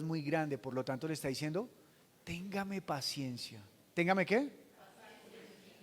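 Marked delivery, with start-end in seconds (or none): 2.2-3.6 soft plea. Acted. Preacher models the servant's plea. 4.4-5.7 whispered word. Acted. Congregation prompted to complete word.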